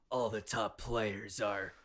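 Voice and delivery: slow, drawn-out edgelord taunt; edgy anime persona. Retold or imitated. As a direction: sneer it out like an anime villain. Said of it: "going overboard with this anime, like, edgy persona".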